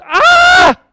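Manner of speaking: shrill, very high, loud scream; demon scream. Acted. The enemy freaking out, screaming very high and loud. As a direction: shriek like a startled demon. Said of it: "the enemy goes".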